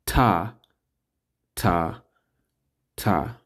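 ta, ta, ta fading away; teacher drilling the syllable ta. Shown as a demonstration. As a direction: sound out each ta. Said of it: "Ta, T A".

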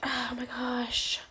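slow breathless whisper; hushed slow oh my gosh; awed whisper. Real, not acted. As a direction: whisper it in awe. Awed by a rare offer.

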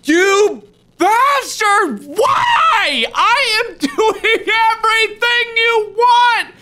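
high, strained anguished yelling; half-laughing anguished shout; frustrated crying shout. Real, not acted. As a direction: wail at the bear in despair. Frustrated yell at animatronic.

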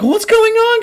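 mock-frightened high squeal. Frightened Empress and girl react to bloodshed, mock fright. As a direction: put on a high, panicked cry. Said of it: "like, frightened".

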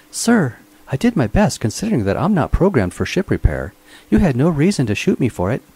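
high, prim robot servant voice, aggrieved; a fussy mechanical butler sulking about being shot; whiny robot voice. A narrator's reading. Robot Minx protests to his master, high and aggrieved.

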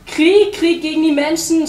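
high, loud war chant; agitated, high-pitched cry; shouting. A narrator's reading. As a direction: yell a call to arms. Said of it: "schrie der Pinguin".

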